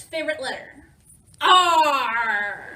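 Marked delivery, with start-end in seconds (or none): 1.4-2.8 loud, drawn-out pirate "Arrr"; pirate "Arr". Acted. Pirate reader delivering a joke's punchline to children, loud pirate cry. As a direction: belt out a long pirate arr.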